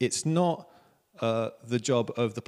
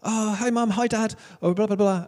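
high-pitched mock phone greeting; chirpy kids phoning home; 9 semitones higher than usual. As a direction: imitate schoolkids ringing their parents, pitched up high. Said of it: "most of them would be there going".

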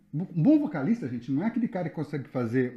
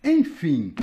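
ordinary talk, nothing marked

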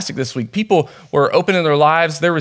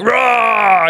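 imitated burly roar, higher pitched; mock yell; 6 semitones higher, 3 dB louder than usual. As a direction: let out a mock bear-scaring roar. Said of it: "he's, like, yelling at this bear".

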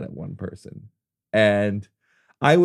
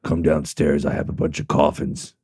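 deep, low undertaker voice; hushed funeral director; 7 semitones lower than usual. Retold or imitated. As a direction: invite them down like a mortician. Continuing the Undertaker bit.

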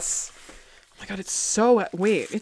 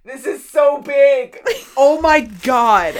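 awed gushing; 5 semitones higher, 4 dB louder, slower than usual. Real, not acted. Staring at the big stone.

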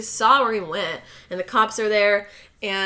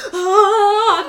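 loud, high mock gasp; dramatic gasp; 11 semitones higher, 9 dB louder than usual. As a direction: gasp theatrically at a big reveal. Retold shocked discovery, dramatic sting follows.

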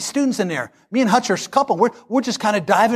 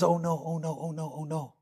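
much softer, sinking inner groan; dread under the breath; 4 semitones lower, 12 dB quieter than usual. Retold or imitated. Students fearing what the friend will say, softly. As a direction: murmur it like dreading what comes. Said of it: "all we can think of is".

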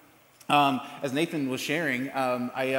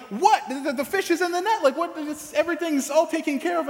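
puzzled brother; 11 semitones higher than usual. Retold or imitated. Brother answering from the boat's front, puzzled: high baffled reply. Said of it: "And he's like".

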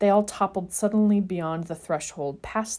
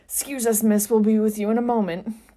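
strained, louder grunting apology; grunted; 4 semitones higher, 6 dB louder than usual. Acted. James to the seer, grunting with effort. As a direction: push the words out while straining. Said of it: "grunted James".

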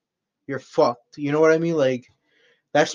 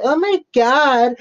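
pitched-up mock of a know-it-all; mocking DM critic; 9 semitones higher, 3 dB louder than usual. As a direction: sneer it like an annoying commenter. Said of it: "people on my fucking D Ms be like".

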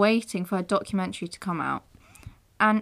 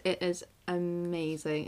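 normal talking voice

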